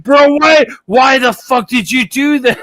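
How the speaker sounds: high, loud imitation of a distraught friend; hammed-up horrified shout; stressed-out friend. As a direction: yell it in mock disbelief, badly stressed. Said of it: "so stressed out".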